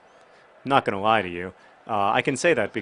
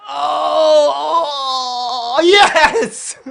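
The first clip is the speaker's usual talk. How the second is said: screaming high and loud with joy; a real cry of triumph at the goal; ecstatic shout; 10 dB louder, much slower than usual. Real, not acted. Scoring the long-awaited goal.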